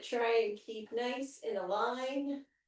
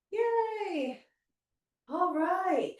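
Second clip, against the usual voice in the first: cheerful yay; 8 semitones higher, much slower than usual. Real, not acted. Cheering at end of set.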